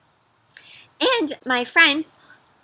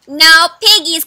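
duck voice; 4 semitones higher, 9 dB louder than usual. Acted. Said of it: "quacked the duck".

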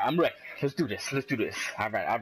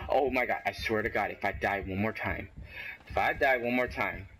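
ordinary talk, nothing marked

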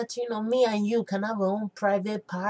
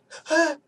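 shocked gasp; 8 semitones higher than usual. Acted. Hearing jollof made with basmati.

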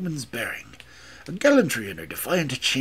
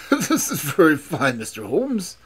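insincere laughter; 3 semitones higher, 5 dB louder than usual. A narrator's reading. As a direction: laugh it off falsely while speaking. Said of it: "burst into sin-, insincere laughter".

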